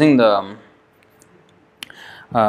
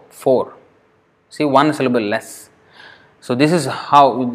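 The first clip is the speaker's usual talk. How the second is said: ordinary talk, nothing marked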